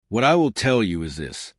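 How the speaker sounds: ordinary delivery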